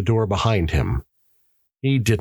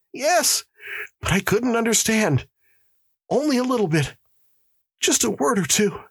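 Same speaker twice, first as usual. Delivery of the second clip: high, anxious voice in short phrases; frightened prisoner; 14 semitones higher than usual. Acted. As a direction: answer in high-pitched distress. Frightened prisoner answers the warden.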